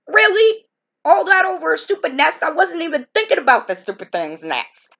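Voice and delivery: you can't believe it was over a nest; incredulous outburst. Retold or imitated. Hearing from the park official that it was the nest, incredulous outburst.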